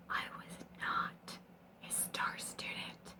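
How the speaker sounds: a slow, soft whispered confession. Acted. Admitting to viewers she failed Italian class, whispered. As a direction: whisper it like a guilty secret.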